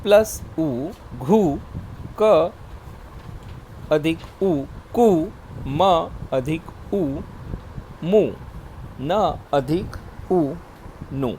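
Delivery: slow spelling demo. Shown as a demonstration. Teacher slowly spelling short उ syllables for children. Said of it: "उसका घुमाव बाई ओर होता है".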